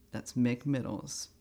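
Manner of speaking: normal talking voice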